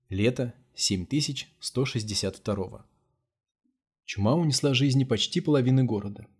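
plain reading voice

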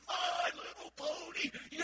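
high, loud, slow delighted shout; thrilled child's cheer; excited kid. Retold or imitated. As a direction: squeal the toy's name. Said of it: "It was like".

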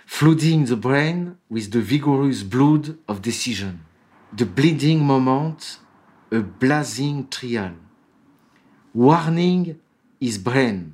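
French-accented English. Shown as a demonstration. Lecturer reads the English original to listeners, in French-accented English. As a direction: read the English quote aloud in a French accent. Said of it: "pardon pour mon accent".

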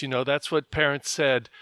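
ordinary delivery

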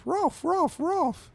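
very high-pitched cartoon dog barks; barking dog. Dog pestering Drabble in his house, high barking. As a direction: yap the words like a small dog. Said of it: "The dog goes".

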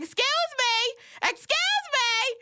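high-pitched, drawn-out mock outrage; Iyanla. Mocking Iyanla on her show, high drawn-out indignation. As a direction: stretch it out in a high, affronted cry. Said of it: "when she like".

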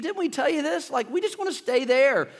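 a hint of a whiny we-told-you-so; whining Israelites. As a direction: Israelites pleading to be left alone, mildly whiny. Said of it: "It says".

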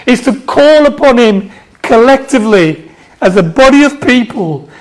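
forceful, emphatic pulpit proclamation; fervent sermon with stretched key words; impassioned preaching. Real, not acted. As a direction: drive home each line to the congregation.

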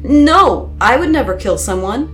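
upset and insistent; distressed protest. A narrator's reading. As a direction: deny it desperately. Accused narrator protesting in distress.

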